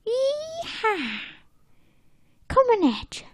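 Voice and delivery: very high cowboy holler; a high, thin mock-cowboy whoop. Cowboys passing by at full speed, whooping.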